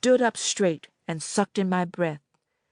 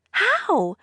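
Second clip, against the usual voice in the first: much higher, stunned; shocked girl; 11 semitones higher, 3 dB louder than usual. A narrator's reading. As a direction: ask in disbelief. Annette's voice.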